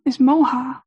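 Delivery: normal talking voice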